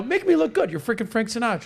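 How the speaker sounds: eager pleading. Retold or imitated. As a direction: beg like you need this badly. Don begs Frank.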